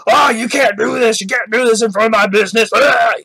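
hammed-up angry complaint, rushing into a shout; mock angry. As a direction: mockingly rant like an outraged businessman, ending in a yell. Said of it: "the guy that's angry because he's like".

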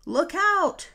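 high, loud warning yell. A narrator's reading. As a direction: shout the warning. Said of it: "yelled Marlin".

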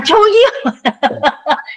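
giggly drawn-out repeat; laughing repeat. Real, not acted. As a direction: laugh through the word. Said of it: "似てるかなと思って".

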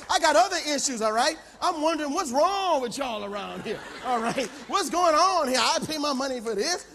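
hammed-up outrage, hammering each complaint; indignant rant. Pastor demanding what's wrong with this gym, indignant and high.

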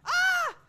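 very high, loud scream of fright; startled piercing scream; real scream. Real, not acted. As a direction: shriek at a sudden jump scare. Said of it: "I'm screaming".

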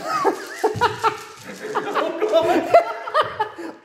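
long high laugh; a long high rolling laugh; high laughter. Real, not acted. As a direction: laugh at your own bad luck. Laughs at his own rolls.

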